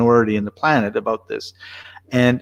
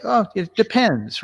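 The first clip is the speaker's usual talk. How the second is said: high, drawn-out, airy quote; retold easygoing reply; 9 semitones higher, slower than usual. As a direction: toss it off slowly, imitating friends. Voicing his Spanish friends' easy answer.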